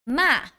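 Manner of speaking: short falling tone on MA; fourth tone. Shown as a demonstration. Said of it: "this is the fourth tone".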